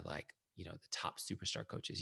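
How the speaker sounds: ordinary talk, nothing marked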